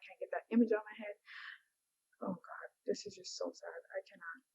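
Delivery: soft unvoiced whisper of sorrow; sad whisper. Real, not acted. Mom upset by the video, whispering sadly.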